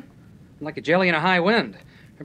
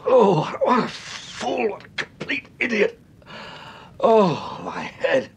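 slow, pained, self-reproaching groan; dazed groaning; 7 semitones higher, slower than usual. Acted. Waking after being knocked out, groaning in pain. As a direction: come round groggy from the blow.

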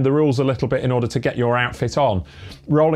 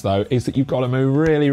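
normal talking voice

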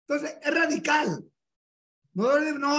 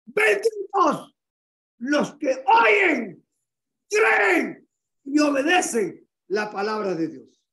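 impassioned proclamation; 4 dB louder, slower than usual. Acted. Preacher shouting Jesus' words to the congregation, tearful. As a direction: cry it out from the pulpit.

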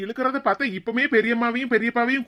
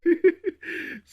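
laugh; 8 semitones higher than usual. Acted. Husband laughs at wife's boast.

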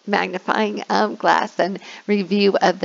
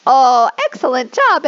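excited praise; 5 semitones higher, 6 dB louder than usual. Shown as a demonstration. Teacher praising the class.

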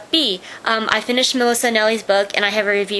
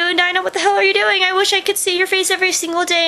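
excited shout-out; 9 semitones higher, faster than usual. Real, not acted.

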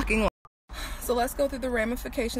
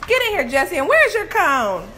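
high, loud call to the dog; 9 semitones higher, 9 dB louder than usual. Real, not acted. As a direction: shout her inside, high and bright. Calling the dog inside.

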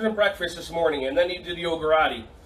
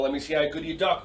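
ordinary talk, nothing marked

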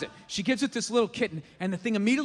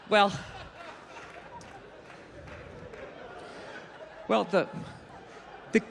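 lower, halting bad-news voice; reluctant zookeeper; 3 semitones lower than usual. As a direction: zookeeper stammering out that the kitten died. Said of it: "She's like, uh".

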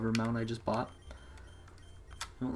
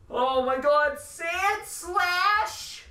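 screaming way higher and louder, the last words stretched slow; excited screaming; 7 dB louder, much slower than usual. Real, not acted. Player screaming at a surprise Sand Slash, ecstatic shriek. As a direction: shriek with disbelief at the catch. Catching a rare Pokemon.

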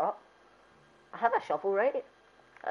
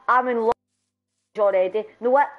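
ordinary talk, nothing marked

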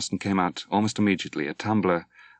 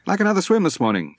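much higher, louder, quick breezy offer; breezy invitation; 12 semitones higher, 6 dB louder, faster than usual. A narrator's reading. Peter invites Dwight for a swim, bright and fast. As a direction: toss off a cheerful invitation.

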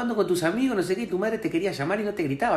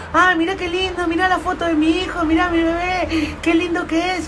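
much higher, cooing; gushing proud mother; 10 semitones higher than usual. Retold or imitated. Proud mother showing her baby photo, gushing. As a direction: coo over the baby photo. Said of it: "te dicen".

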